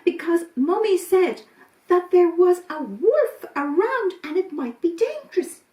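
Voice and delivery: put-on high little-kid voices; earnest small children repeating a warning; little kids explaining. Goat kids tell the rabbits about the wolf, high-pitched.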